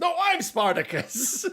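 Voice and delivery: Spartacus declaration. Acted. Film fan acting out the I'm Spartacus scene, mock declaration. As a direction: pipe up as a rival Spartacus. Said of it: "Where is the whole".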